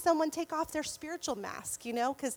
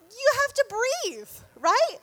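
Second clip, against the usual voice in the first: higher and louder, breaking into a laugh; laughing exasperation; 7 semitones higher, 6 dB louder than usual. Real, not acted. Telling her group about giving birth, laughing in disbelief. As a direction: laugh through the point in disbelief.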